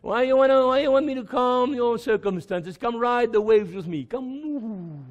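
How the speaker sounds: a quiet, tender call to Peter; Jesus calling gently. Acted. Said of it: "He said".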